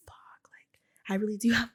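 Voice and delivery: near-whispered retold curse; muttered inner dread. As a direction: say it under your breath, as a thought. Retelling a dismayed private thought.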